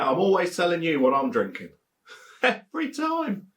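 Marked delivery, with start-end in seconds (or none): 2.1-3.5 laughing. Real, not acted. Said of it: "laughs while teasing viewers".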